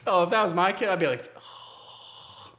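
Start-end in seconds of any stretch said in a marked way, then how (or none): 1.4-2.5 long voiceless gasp; hammed-up parental gasp; mock shocked gasp. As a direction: draw in a horrified breath, acted. Acts out horrified parent gasping.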